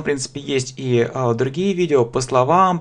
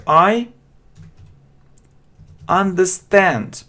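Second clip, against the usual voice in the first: slow model sentence; 5 semitones higher, much slower than usual. Shown as a demonstration. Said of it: "teacher models the sentence".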